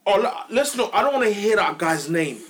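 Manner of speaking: usual speaking voice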